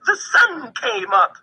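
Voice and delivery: high, bright little chirp; chirping squirrel. A narrator's reading. Squirrel chirping gleefully at the dawn. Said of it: "he chirped".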